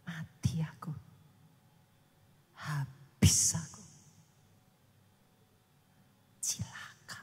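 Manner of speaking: whispered, slow self-doom; despairing whisper. Retold or imitated. Preacher imitating someone whispering doom on himself. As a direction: whisper your own ruin to yourself. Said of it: "saudara bilang".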